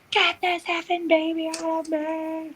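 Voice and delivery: high, strained retold scream; mock scream. Mother retelling her labour to friends, mock screaming. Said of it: "I'm screaming".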